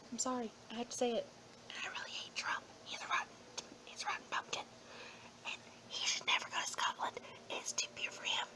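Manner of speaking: hushed, secretive whisper, quick at first; conspiratorial whisper. Real, not acted. Confiding to viewers about Trump in a whisper. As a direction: lean in and whisper the insult.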